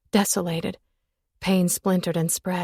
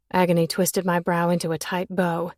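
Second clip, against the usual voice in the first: ordinary delivery, nothing marked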